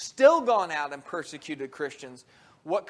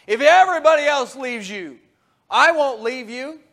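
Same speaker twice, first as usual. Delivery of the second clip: bold promise; 5 semitones higher, 10 dB louder than usual. Acted. Peter's bold blurted promise.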